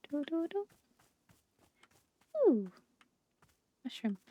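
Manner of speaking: ordinary delivery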